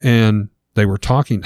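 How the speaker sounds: usual speaking voice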